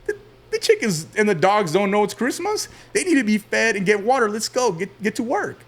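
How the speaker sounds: a hint of a strict grandfather, pitched high; lightly voiced no-nonsense grandfather; stern grandpa. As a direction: imitate grandpa ordering the kids to their chores. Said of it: "My grandpa's like".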